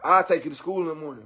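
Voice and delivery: low, quick soft give-in again; soft giving in. Retold or imitated. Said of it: "broke down and been like".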